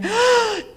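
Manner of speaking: high gasp of relief; girl surfacing, gasping for air. A narrator's reading. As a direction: gulp air after nearly drowning. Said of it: "Tracy gasped as she took a big breath".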